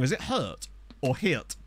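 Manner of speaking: "Hurt" then "Hirt", slow and careful; pronunciation comparison. Shown as a demonstration. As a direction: say "Hurt" and "Hirt" slowly, weighing the vowels. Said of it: "How is it actually pronounced".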